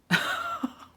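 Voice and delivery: very high squeal of laughter. Real, not acted. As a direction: burst out in a shrieking laugh. Reacting to story's punchline.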